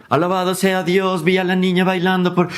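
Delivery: high, sustained monotone chant; crazy little man reciting a psalm. Acted. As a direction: intone it like scripture. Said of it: "empieza a recitar un salmo".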